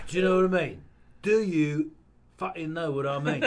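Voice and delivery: loud Cockney hardman; barked London threat; Jason Statham impression. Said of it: "how to do Jason Statham".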